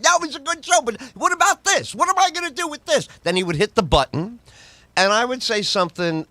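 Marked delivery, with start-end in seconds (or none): 0.0-3.1 hammed-up whiny boss, much higher; high mock boss. Boss mocking the host over a tape, squeaky whine.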